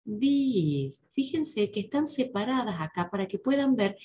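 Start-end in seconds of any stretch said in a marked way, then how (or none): 0.0-1.1 "these" stretched out, weight on the first part of the ee; long-vowel these. Shown as a demonstration. Teacher repeating plural "these" for students, long stressed vowel. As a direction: hold the ee in THESE and let it fall. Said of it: "que en la primera I va a ir el acento".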